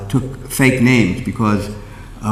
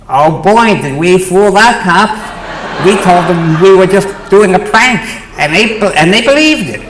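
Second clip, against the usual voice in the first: gloating radical voice; 9 semitones higher, 6 dB louder than usual. Retold or imitated. Gloating on air as Danny.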